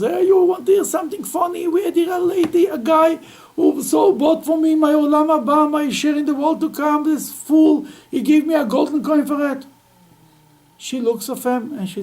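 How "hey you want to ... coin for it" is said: high, loud gloating tale of a fool; gloating husband. Acted. Husband boasts to his wife of the fool, high loud gloating. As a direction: crow about the joke, much higher.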